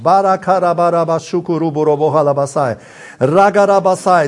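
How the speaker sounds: fluent, flowing glossolalia; praying in tongues. Real, not acted. Preacher praying in tongues before the congregation, flowing and fervent. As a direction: let the tongues pour out steadily.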